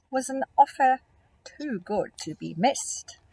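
lilting rhymed read-aloud; storybook reading. A narrator's reading. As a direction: read the rhyme to small children. Rhyming story narration.

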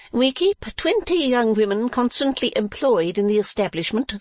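high proprietress voice; Madame Mantalini. Acted. Madame doing business with visitors, high character voice. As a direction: pitch it well up as the madame.

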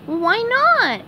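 high cartoon question; SpongeBob questioning. A narrator's reading. SpongeBob questions Patrick about returning eggs, puzzled. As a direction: ask it, pitched high. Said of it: "SpongeBob asked".

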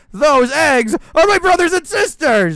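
loud, high outraged cry; angry shout. Acted. Goku bird reminding the predator of his siblings, loud outraged shout. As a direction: yell it in his face.

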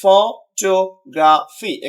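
"Photography" slowly as ফ, টো, গ্রা, ফি; four separate syllables. Shown as a demonstration. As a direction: break "photography" into slow, even pieces. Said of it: "চারটা syllable রয়েছে". ("Photography" ধীরে ভেঙে ভেঙে)

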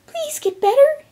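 tiny, very high pleading voice; high-pitched, desperate plea; begging. A narrator's reading. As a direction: beg in a squeaky little voice. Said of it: "she begged".

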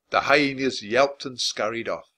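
ordinary delivery

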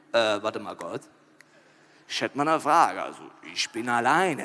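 low, halting, thinking aloud; puzzled, low-voiced hesitation; hesitant question. Adam hesitantly approaches God with question.

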